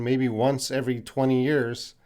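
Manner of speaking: ordinary talk, nothing marked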